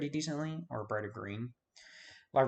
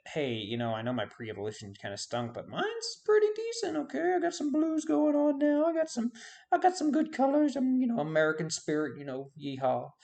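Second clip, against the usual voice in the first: a much higher, hammed-up Southern accent as a cocky bird; 13 semitones higher, 5 dB louder than usual. Braviary showing off its shiny colours, in a mock Southern accent. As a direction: play the bird bragging about its colours in a put-on Southern accent. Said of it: "I don't know why I went Southern".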